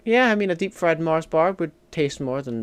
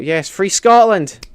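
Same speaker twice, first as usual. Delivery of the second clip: loud, slow, raised cry; rallying cry; 4 semitones higher, 9 dB louder, slower than usual. Real, not acted. Streamer backs Scottish independence to chat, rallying cry. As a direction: call it out like a slogan. Cheers a controversial viewer message.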